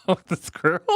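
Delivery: high words squeezed through laughter; helpless giggling. Real, not acted. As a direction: barely get it out for laughing. Giggling at own drawing.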